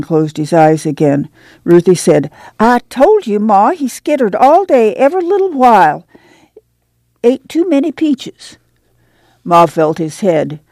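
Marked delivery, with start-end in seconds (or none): 2.6-8.6 put-on excited young girl; high child voice. A narrator's reading. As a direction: blurt it out as a girl. Ruthie reports to Ma.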